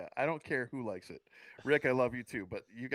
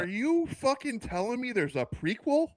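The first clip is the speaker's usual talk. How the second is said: high-pitched disbelief; can't believe what he's hearing; incredulous; 11 semitones higher than usual. Real, not acted. Disbelief at prequel news.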